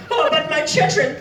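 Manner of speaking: high whiny mockery of the father; mock whiny customer. Server mocks the customer's children plea, high whiny sing-song. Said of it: "he went".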